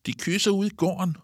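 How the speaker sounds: high, teasing playground call; children calling out. A narrator's reading. Children telling the substitute teacher on classmates, high call. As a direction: sing out the gossip, pitched high. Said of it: "råber nogle børn".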